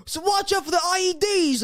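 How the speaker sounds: high-pitched battlefield report, hammed up; mock movie soldier. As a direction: parody urgent military radio talk. Mocking military movie dialogue.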